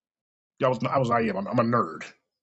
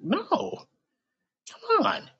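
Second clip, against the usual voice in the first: much higher, incredulous come on; incredulous reassurance; much slower than usual. Retold or imitated. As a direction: brush off her worry kindly.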